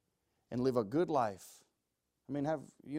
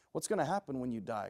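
ordinary delivery

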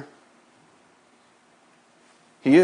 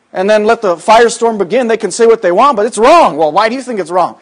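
ordinary delivery

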